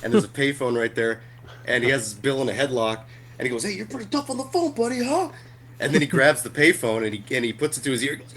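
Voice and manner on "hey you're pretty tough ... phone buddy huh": much higher, quick, taunting bully voice; mocking tough guy. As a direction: jeer at him fast while holding him down. Voicing the guy during the headlock.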